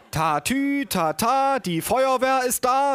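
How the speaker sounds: very high siren-like chant; aunt reading aloud. A narrator's reading. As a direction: read a children's book aloud, hammed-up and high. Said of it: "las meine Tante vor". (Tante liest übertrieben vor)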